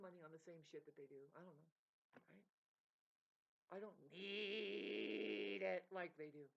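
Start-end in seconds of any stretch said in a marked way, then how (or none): 3.7-5.9 NEED hugely drawn out and loud; stress on NEED. Real, not acted. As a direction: stretch "need" into a long, loud wail. Insists on needing less.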